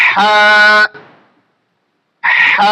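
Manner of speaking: হা said with continuous airflow from mid-throat; breathy Arabic ha. Shown as a demonstration. Teacher drilling the letter for students, airy হা. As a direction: keep the breath flowing on হা, mouth not wide. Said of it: "বাতাসটা জারি থাকবে".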